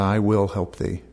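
usual speaking voice

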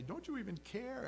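high, pleading disciple whine; whiny panicked plea. Retold or imitated. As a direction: beg like a scared fisherman waking him. Panicked disciples wake Jesus.

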